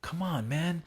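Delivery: high-pitched urging pep talk; motivational dad. Acted. Father balancing both styles, motivational urging. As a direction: push your son to want it. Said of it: "it's kinda like motivational speech".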